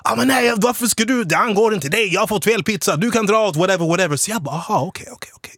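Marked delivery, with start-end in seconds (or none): 0.0-4.2 high-pitched mock protest, volume held in; indignant customer. Pizza customer telling him to stay out of it, high indignant imitation. Acted angry customer arguing back. 4.6-5.5 very soft, nearly whispered okays; hushed acknowledgement. Retold or imitated. Replying to the rude customer, hushed and restrained.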